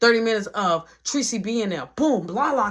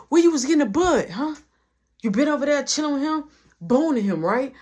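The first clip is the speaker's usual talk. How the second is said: accusing; 6 semitones higher, 5 dB louder, faster than usual. Retold or imitated. Retold quarrel, interrogating girlfriend.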